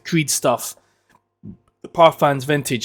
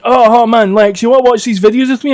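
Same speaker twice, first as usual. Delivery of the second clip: put-on higher, louder excited kid voice; eager teenager; 6 semitones higher, 5 dB louder than usual. Fifteen-year-old inviting him to watch videos, high mock eagerness. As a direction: gush like an over-keen youngster. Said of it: "But he's like".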